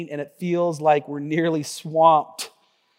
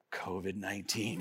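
quiet laughing answer; 10 semitones lower, 17 dB quieter than usual. Retold or imitated. Family answers together.

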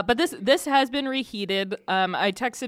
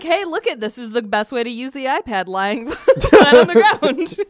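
laughing mock presenter; 8 dB louder than usual. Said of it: "Just be like".

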